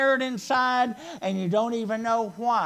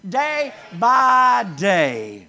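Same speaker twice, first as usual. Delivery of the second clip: slow, louder "Day by day" for all to echo; call and response; 6 dB louder, much slower than usual. Shown as a demonstration. Preacher leading the church to repeat the phrase. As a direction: lead the room in "Day by day". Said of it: "Can you say that with me".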